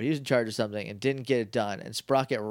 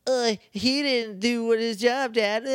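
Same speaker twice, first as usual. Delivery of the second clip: put-on whiny kid voice; 8 semitones higher than usual. Sprocket tattling to his dad, whiny. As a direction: whine it like a spoiled prince tattling.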